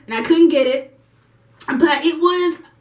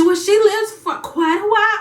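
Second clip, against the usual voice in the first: nurse imitated a little higher and airy; mock nurse voice; 8 semitones higher than usual. Retelling the nurse's answer to a mother in labour, mock airy. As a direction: lightly put on a casual nurse's tone.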